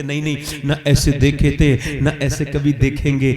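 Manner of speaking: loud, ringing preacher's cry; emphatic shout. Real, not acted. Preacher shouting to the congregation, loud. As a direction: proclaim it with full force to the crowd.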